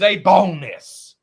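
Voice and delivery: BONUS hit loud and drawn out; emphatic stress. Real, not acted. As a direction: punch the word bonus hard, stretching it slowly. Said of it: "Let me say that again".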